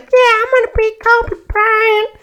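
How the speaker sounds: very high, squeaky kid impression; mock excited kid. Kid dreaming of basketball stardom, mock excited kid.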